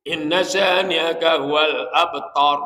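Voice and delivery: Quranic recitation with thick, heavy consonants; slow, loud Quran chant; heavy tafkhim recitation. Shown as a demonstration. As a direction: recite slowly, making each emphatic letter full and dark. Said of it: "tafhim tebel".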